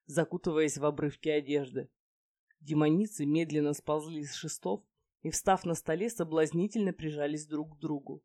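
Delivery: ordinary delivery, nothing marked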